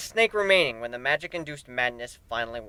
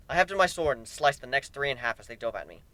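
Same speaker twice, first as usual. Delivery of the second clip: ordinary delivery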